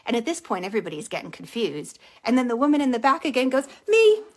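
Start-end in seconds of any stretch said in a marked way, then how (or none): 3.9-4.2 high, loud eager shout; eager claim. Retold or imitated. Woman in the back claims the bill, eager shout. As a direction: pipe up loudly from the back.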